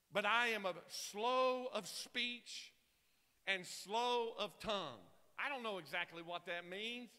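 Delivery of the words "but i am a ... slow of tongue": drawn-out, very slow reading with pauses; slow of speech. Acted. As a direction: stretch the words out like a slow talker. Reads Moses calling himself slow of speech.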